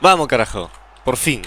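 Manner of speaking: loud goal celebration; celebrating shout. Real, not acted. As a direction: yell it out in triumph.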